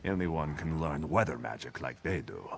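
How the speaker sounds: soft scornful whisper; whispered aside. Acted. Sailor scorning the sea elves' claims, soft whispered aside. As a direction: hiss it low as a confidential aside.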